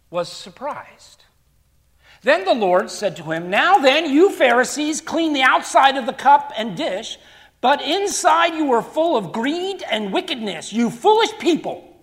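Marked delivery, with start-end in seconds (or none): 3.5-11.9 loud, high, rebuking preacher voice; forceful scripture preaching. Acted. Preacher voicing Jesus scolding the Pharisees, loud and high.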